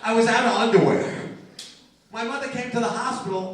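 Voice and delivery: ordinary talk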